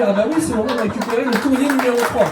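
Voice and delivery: chirpy inner voice. Retold or imitated. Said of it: "ça me dit".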